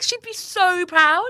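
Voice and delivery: high, loud, drawn-out gushing; hammed-up teary pride; retold tearful pride. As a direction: stretch it out, overcome with pride. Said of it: "like, crying".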